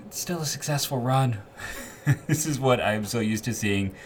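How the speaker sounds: chuckling through the words, voice lifted high; laughing. Real, not acted. Podcast host to listeners about the Browns, laughing. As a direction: laugh it out, amused and a bit rueful. Laughing at rival team's woes.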